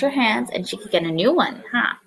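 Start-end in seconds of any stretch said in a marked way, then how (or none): none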